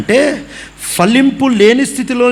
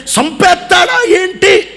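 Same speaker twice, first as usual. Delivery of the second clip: alarmed tree; 10 semitones higher, slower than usual. Retold or imitated. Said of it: "అన్నట్టే".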